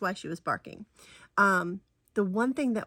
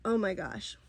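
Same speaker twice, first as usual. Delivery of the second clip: ordinary talk, nothing marked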